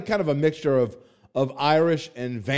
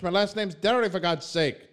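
ordinary talk

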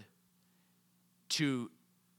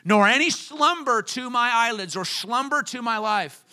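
full-force preaching; 5 semitones higher, 8 dB louder than usual. Acted. Pastor urges the congregation toward David's vow, preaching loud and high.

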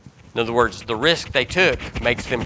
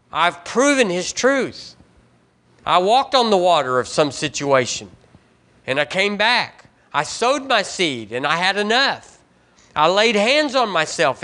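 full-force preaching; 5 semitones higher than usual. Acted. Preacher proclaims Psalm ninety-one to the congregation, full force. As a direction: preach it hard, lifting every phrase.

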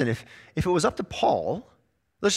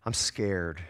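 mock scared; 8 semitones lower, 6 dB quieter than usual. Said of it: "put your head under your pillow and say".

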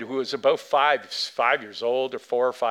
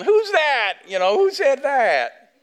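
high, loud, astonished calling out; surprised crowd; 13 semitones higher, 7 dB louder, slower than usual. Retold or imitated. The audience reacting to the boy, loud high surprised voices. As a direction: people turning round asking who shouted.

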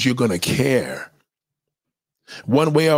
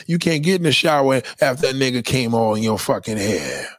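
ordinary talk

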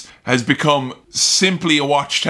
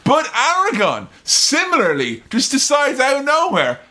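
ordinary delivery

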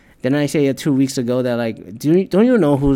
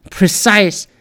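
high, loud barked commands; mock drill yell; 7 semitones higher, 8 dB louder than usual. Bossing people onto a line, high loud yell. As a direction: yell the orders like a drill sergeant.

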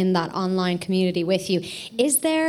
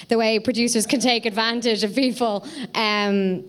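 ordinary talk, nothing marked

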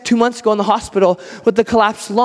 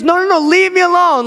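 acted higher-pitched brush-off; annoyed refusal; 7 semitones higher, 3 dB louder than usual. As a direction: imitate a woken dad sending someone away. Woken father refuses the caller.

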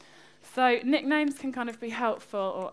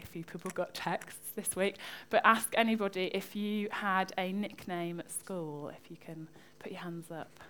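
ordinary delivery

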